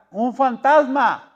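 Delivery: high, loud, drawn-out shout; frightened cry. Acted. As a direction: cry out slowly in alarm, like terrified disciples. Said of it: "y gritaron".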